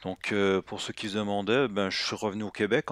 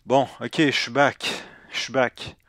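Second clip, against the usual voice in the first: put-on Québécois accent; 3 semitones higher, 7 dB louder than usual. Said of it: "avec mon nouvel accent québécois".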